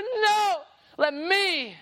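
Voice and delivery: a hint of the daughter begging; high pleading girl. Daughter begging her dad to punish her instead.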